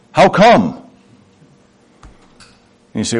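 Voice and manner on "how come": put-on higher, louder toddler's question; lightly mocked toddler voice; imitating a toddler. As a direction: mimic a small child demanding a reason. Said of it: "It comes out in toddlers".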